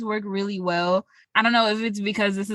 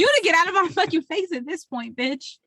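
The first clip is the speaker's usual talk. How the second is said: higher, exasperated mock rant; mock outrage; 7 semitones higher than usual. Friend pretending to snap at the waitress, exasperated. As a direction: push her away in mock outrage.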